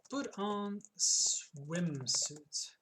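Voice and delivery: very slow, each word stretched; slow drawn-out. Real, not acted. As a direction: drag the command out. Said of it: "typing command read slowly".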